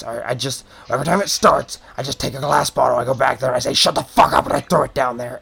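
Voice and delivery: mildly agitated goblin barkeep; a touch of defensive, grumbling exasperation; worked-up goblin. Acted. Goblin admits what he saw to questioner, worked up. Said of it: "he's very worked up about it".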